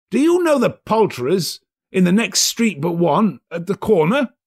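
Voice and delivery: put-on asking the boy urgently; cheerful old man; eager Scrooge. Scrooge voiced.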